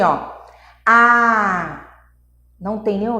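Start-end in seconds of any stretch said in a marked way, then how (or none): 0.8-1.9 "A" as an open, held vowel; vogal sustentada. Shown as a demonstration. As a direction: sustain "A" with the air flowing freely. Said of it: "quando eu digo assim". ("A" prolongado, sem obstáculo ao ar)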